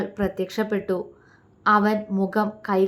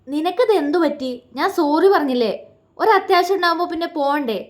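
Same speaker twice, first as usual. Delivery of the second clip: raised, fast irritated questioning; husband losing patience with silent wife, angry; 6 semitones higher, 3 dB louder, faster than usual. A narrator's reading. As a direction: snap at her in frustration. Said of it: "ദേഷ്യത്തിൽ ശിവ ചോദിച്ചു".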